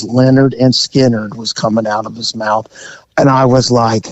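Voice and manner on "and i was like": ordinary talk, nothing marked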